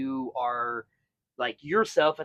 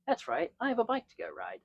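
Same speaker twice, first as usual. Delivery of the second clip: quick, higher, softer self-talk; cheery inner voice; 8 semitones higher, 8 dB quieter, faster than usual. Retold or imitated. Stressed rider cheering himself up, quick and light. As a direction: perk up at the thought of riding.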